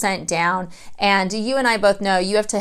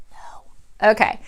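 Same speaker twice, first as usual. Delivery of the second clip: whispered no; slower than usual. Real, not acted. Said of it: "whispered no".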